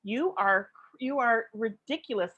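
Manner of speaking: normal talking voice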